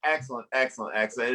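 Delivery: normal talking voice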